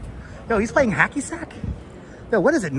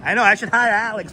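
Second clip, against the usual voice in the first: ordinary talk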